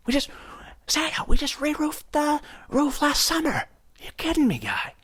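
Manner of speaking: high, strained voice scolding Santa; shrill-pitched complaint to Santa on the roof; mock homeowner. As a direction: play the fed-up homeowner yelling up at the roof. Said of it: "homeowner scolding Santa".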